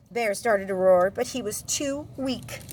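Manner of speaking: ordinary delivery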